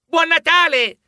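put-on children shouting Merry Christmas on the way home; children's shout. A narrator's reading. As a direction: shout it across the schoolyard. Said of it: "si auguravano i bambini".

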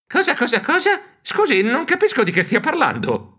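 high stammered confusion; stammer, baffled; high squeaky stammer. Acted. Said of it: "Io balbettai".

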